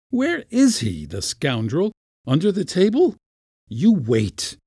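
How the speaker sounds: high, indignant hunting questions; furious. A narrator's reading. Uncle hunts the runaway kitten, furious. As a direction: demand the culprit, then threaten. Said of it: "looking furious".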